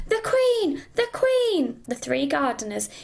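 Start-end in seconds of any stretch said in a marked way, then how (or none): 0.1-1.8 high-pitched warning call. A narrator's reading. Five warning the other gardeners, calling out. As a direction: call it out high, each cry falling at the end. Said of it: "called out".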